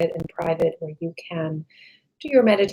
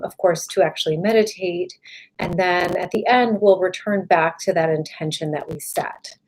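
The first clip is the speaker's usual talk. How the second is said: ordinary talk, nothing marked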